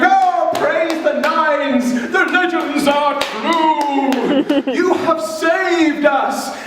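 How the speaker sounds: high, slow, hammed-up villager cheer. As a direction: praise the hero in a drawn-out chorus. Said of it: "they'll be going".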